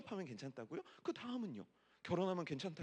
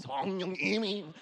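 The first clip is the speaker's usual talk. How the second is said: imitating singer; 6 dB louder, much slower than usual.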